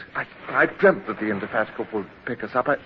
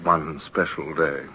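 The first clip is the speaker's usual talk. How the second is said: somber narration; 7 semitones lower than usual. A narrator's reading. Old Hornblower narrates despair.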